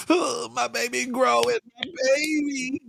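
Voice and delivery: high, slow mock sob; mock crying. Grandmother at the prom, mock teary wail. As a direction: tease her by faking teary-eyed sobs. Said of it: "you gonna be tearing up like".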